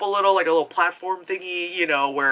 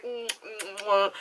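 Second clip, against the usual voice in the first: higher soft mock moans; mock moaning; 4 semitones higher, 5 dB quieter than usual. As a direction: moan it, hamming it up. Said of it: "she's like".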